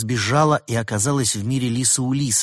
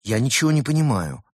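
louder, higher-pitched grumble; displeased; 5 semitones higher, 5 dB louder than usual. A narrator's reading. Veronica tells Ulysses she is lost, displeased. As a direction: complain in puzzled annoyance. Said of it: "недовольно спросила Вероника".

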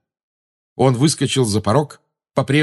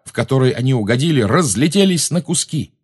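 ordinary delivery, nothing marked

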